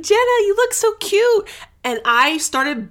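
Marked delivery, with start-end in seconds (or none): 0.0-1.5 high, loud imitation of a doting dad; exaggerated high-pitched compliment; gushing dad. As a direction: gush over a photo like a proud parent. Imitates dad gushing over photo.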